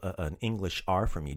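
ordinary delivery

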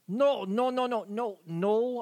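high, insistent run of no's; storming down the beach, high repeated no; Anna Meek storming in. Acted. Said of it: "And she goes".

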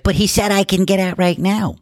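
high, put-on whine of a demanding woman; mock her insisting like a spoiled child; whiny entitled lady. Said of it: "And she's like".

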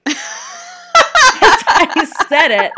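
high, loud, slow burst of laughter; crying with laughter. Real, not acted. Just realized what she read out loud, crying with laughter. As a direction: break up laughing at the hidden joke. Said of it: "I'm crying".

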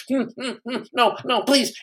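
high, soft whimpering pleas; mock-scared class moaning no. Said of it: "the whole class is going".

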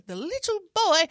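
put-on little boy. Improviser naming the orphan boy character, little boy voice. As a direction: slide up into a small boy's voice.

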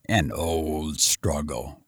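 low, slow, quiet grunt; gruff commissioner. Acted. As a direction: mutter it heavily like a tired official.

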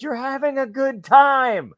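high, slow, sing-song coaxing; mock-cheery performer talking to a deaf old lady; a hint of patronising cheer; forced cheerful. Reassuring old lady while performing.

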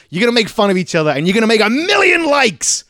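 fast, high, loud hype; hyped-up pitch. Retold or imitated. Media team hyping Rock and Kevin Hart, fast and high. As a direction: build the excitement to a peak.

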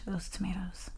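breathy whisper of longing. Real, not acted. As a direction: whisper it, savouring. Savouring remembered tomatoes.

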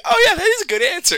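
high, loud fake enthusiasm; mock game-show cheer. Mocking Family Feud teammates, overdone cheer. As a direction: cheer it like a supportive teammate, hammed up.

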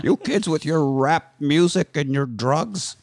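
put-on slow, laughing cranky old geezer; grumpy old man. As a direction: grumble at the kids, slowly. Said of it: "Old Man".

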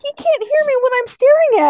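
high, loud exasperated shout; retold shouted protest to grandma; yelling. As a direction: yell it in comic disbelief. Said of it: "You're over here yelling".

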